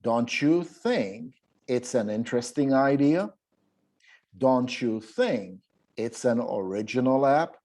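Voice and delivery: slow, rising on "you" and "think"; model reading. Shown as a demonstration. Teacher models the remaining items slowly. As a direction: read the questions with a lift at the end. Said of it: "the practice that we're going to be doing".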